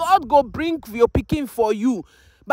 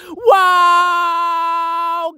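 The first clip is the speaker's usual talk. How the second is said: loud, higher crying wow; amazed wow; 5 semitones higher, 4 dB louder than usual. Real, not acted. Mother opening her children's gift, overwhelmed. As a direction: let out a tearful wow.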